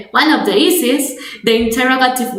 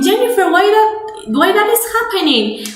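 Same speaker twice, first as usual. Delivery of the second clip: high, quick voice asking "why that is happening?"; puzzled student; 8 semitones higher, faster than usual. Pretend. Teacher voicing a student asking her why, higher and quicker. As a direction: pitch up as a confused student. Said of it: "And you are maybe asking".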